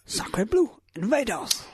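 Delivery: high, hammy French exclamation; over-the-top French chef. Retold or imitated. Mocking Lumiere's French accent.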